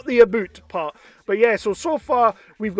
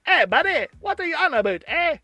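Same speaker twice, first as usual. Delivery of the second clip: high cartoon Canadian accent; hammed-up Canadian buddy; Terrence and Phil; 7 semitones higher than usual. Faking the accent via South Park, high mock Canadian. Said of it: "you just do Terrence and Phil".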